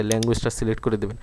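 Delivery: normal talking voice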